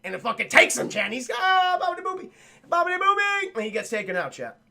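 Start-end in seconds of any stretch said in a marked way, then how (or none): 1.3-3.6 high, goofy babble; silly gibberish. Acted. Archaeologist babbles nonsense high as the alien takes him. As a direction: squeal nonsense as you're carried off.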